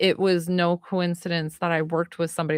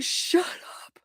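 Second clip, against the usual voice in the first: high breathy whisper of disbelief; stunned disbelief; 10 semitones higher, 4 dB quieter than usual. Retold or imitated. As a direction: gasp it out, overwhelmed. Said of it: "we were screaming".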